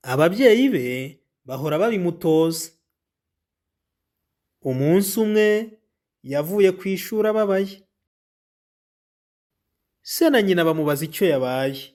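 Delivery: clear, even storybook reading; reading to children. Acted. Teacher reading the Devota story to pupils, clear and even.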